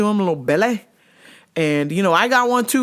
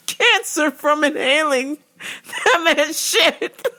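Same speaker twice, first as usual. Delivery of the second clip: laughing; 8 semitones higher than usual. Real, not acted. Laughing at his own story.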